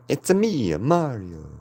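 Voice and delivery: high, bouncy Italian-plumber voice; hammed-up Mario catchphrase; Mario impression. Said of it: "oh, Mario".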